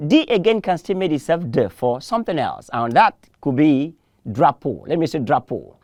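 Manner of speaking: ordinary talk, nothing marked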